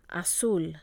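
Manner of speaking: "Azul" with the weight on ZUL; stress on last syllable. Shown as a demonstration. Teacher models the rule for repeating, last-syllable stress. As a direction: punch the second syllable of "Azul". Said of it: "you must stress the last syllable".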